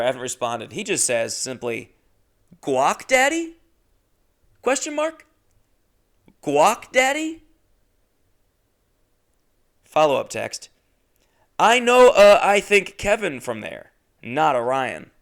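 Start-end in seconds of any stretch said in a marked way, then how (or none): 2.6-7.4 much higher questioning voice; high puzzled quote; baffled text read. Retold or imitated. 11.6-15.1 higher, louder, slowed mock voice; lightly dopey text voice; mock read of text. Reading his text.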